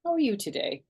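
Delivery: bright put-on politeness, a bit high; overly polite little hello; very pleasant. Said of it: "very pleasant".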